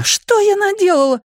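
high-pitched shock at oneself; woman's high, dismayed outcry; horrified cry. A narrator's reading. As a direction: cry out aghast at your own deed. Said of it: "вскрикнула она".

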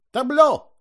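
sudden high, loud shout. A narrator's reading. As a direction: bark the cue out to the stage. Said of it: "крикнул он".

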